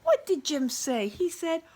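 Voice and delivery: ordinary delivery, nothing marked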